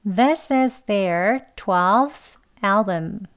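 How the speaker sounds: This is their twelfth album said slowly, every word apart; slow and careful. Shown as a demonstration. Teacher repeating the sentence slowly for students. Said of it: "한 번 더요".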